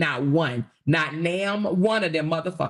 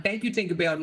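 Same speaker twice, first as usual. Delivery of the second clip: ordinary talk, nothing marked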